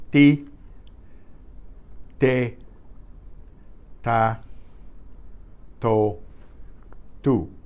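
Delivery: crisp Spanish T, no puff of air; each syllable clipped and clean, T without aspiration; Spanish unaspirated T syllables. Shown as a demonstration. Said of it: "So we'll go through the T I, T E, T A, T O, T U syllables here".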